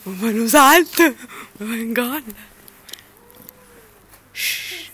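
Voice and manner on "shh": whispering. Real, not acted. Hushing while watching animals.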